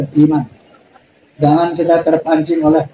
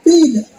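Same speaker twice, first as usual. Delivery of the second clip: a sharp, high, firm "Tidak"; preacher's firm answer to his own question; emphatic no; 8 semitones higher than usual. Real, not acted. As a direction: cut it off decisively.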